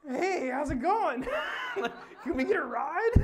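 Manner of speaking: high, loud cheery call through laughter; hollering hello while cracking up; laughing mock greeting. Laughing high and loud, greeting panicked strangers from the roadside.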